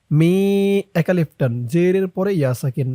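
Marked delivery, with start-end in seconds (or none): none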